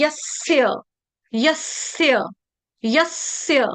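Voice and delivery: यस्य as yas-sya, with a held hissing s; correct joined consonant. Shown as a demonstration. Teacher drilling students, held-s pronunciation. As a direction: double the स in यस्य, falling end. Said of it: "इसमें मैं यसय नहीं पढ़ रही".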